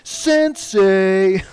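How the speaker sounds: high, loud cartoon turtle voice. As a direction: call it out like a cartoon teen turtle. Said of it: "the voices of the Teenage Mutant Ninja Turtles".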